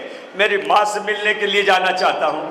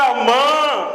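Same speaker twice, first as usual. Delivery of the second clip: a louder call of amma from outside; second, stronger call at the closed door; 2 semitones higher, much slower than usual. Retold or imitated. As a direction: raise the voice to call mother. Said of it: "ذرا زور سے".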